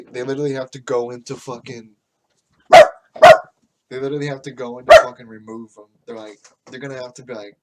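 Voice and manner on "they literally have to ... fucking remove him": much louder and fast, the rant boiling over; loud, rushed exasperation; exasperated outburst. Real, not acted. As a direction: blurt it out in frustration. Restarts the rant, louder second time.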